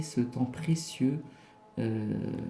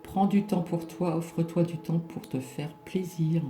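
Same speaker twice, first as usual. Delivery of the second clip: card reading; 4 semitones higher than usual. Acted. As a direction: read the oracle card message out. Reading the card message aloud.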